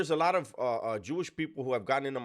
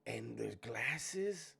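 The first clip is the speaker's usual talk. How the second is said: ordinary talk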